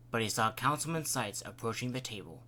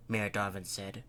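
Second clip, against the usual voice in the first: plain reading voice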